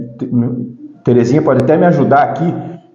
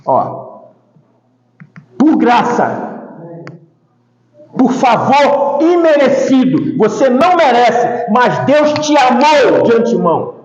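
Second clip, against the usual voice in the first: higher sermon voice with long pauses; emphatic preaching; 7 semitones higher than usual. Acted. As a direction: proclaim it to the congregation, phrase by phrase.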